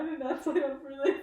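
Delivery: quick tearful protest; in tears. Real, not acted. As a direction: protest while crying and laughing. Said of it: "is bringing you to tears".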